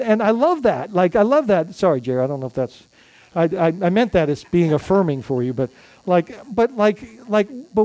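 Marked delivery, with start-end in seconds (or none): none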